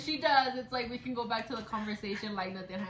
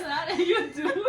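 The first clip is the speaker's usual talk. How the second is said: laughing; 7 semitones higher, 8 dB louder, slower than usual. Real, not acted. Laughing reply to the friend.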